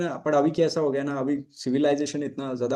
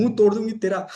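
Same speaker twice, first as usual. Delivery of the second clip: retold yell; 7 semitones higher than usual. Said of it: "वो चिल्ला चिल्ला के बोल रही".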